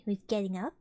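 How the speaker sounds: ordinary delivery, nothing marked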